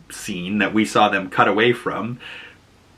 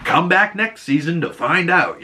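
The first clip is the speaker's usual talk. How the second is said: mock TV teaser; 8 semitones higher than usual. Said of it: "And it's like".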